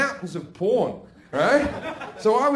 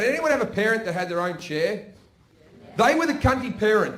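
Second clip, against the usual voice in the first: ordinary delivery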